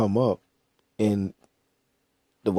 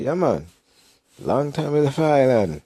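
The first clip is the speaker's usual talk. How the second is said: put-on Jamaican accent; 7 semitones higher than usual. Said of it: "the Jamaican accent".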